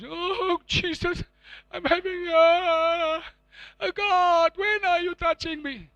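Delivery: high, drawn-out mock wail. Preacher imitating most Christians' prayers, high drawn-out mock pleading. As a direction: plead like a needy, self-pitying believer. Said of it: "But what is most Christians?".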